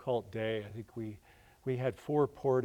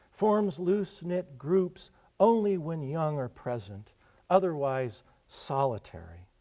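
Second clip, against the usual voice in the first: field guide reading; 3 semitones higher, 8 dB louder than usual. Acted. Poet reading his mock field guide to the audience, measured and louder. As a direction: read it out like a dry nature reference.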